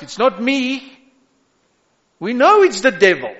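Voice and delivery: high, loud preacher's cry; preaching at full force. Acted. Pastor assuring the congregation, in full preaching cry. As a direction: lift the church with a ringing call.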